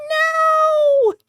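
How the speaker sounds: very high, loud drawn-out cry; shouted refusal. Pretend. Player refusing to give up the mouse, high shouted no. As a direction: wail your refusal high and long.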